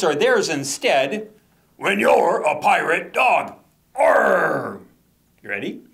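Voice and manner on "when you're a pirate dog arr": slow, hammed-up pirate chant; pirate refrain.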